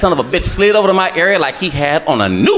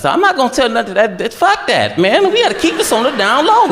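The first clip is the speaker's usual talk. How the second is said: excited agreement; 6 semitones higher than usual. Retold or imitated. Mocking secrecy, high.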